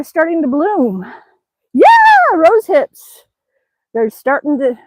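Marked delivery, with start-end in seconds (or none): 1.7-2.3 high, loud burst of joy; excited yelp. Real, not acted. Vlogger thrilled to spot rose hips, excited yelp. 2.3-3.2 said out loud, higher and louder; excited. Real, not acted. Vlogger showing rose hips to viewers, excited aloud. Pointing out berries on a walk.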